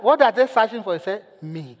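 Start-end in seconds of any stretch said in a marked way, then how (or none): 1.4-1.8 tiny hushed answer; quiet punchline. Retold or imitated. Child revealing whom the search team seeks, hushed. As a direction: drop it very soft, as the child. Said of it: "answered in a quiet tone".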